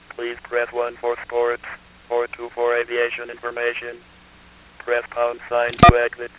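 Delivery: robotic phone menu on one pitch; monotone. Shown as a demonstration. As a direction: read the menu without any inflection.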